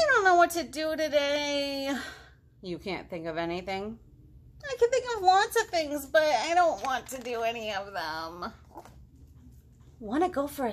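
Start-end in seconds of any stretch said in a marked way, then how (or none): 0.1-1.9 whiny frog. Acted. Bored frog whines to dad. 4.6-8.5 high, bored little frog voice; whiny young frog. Acted. Frog voice in read story.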